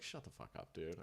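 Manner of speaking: whispered brush-off; whispered mock dismissal. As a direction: hiss it under your breath, embarrassed. Hero brushing off compliment.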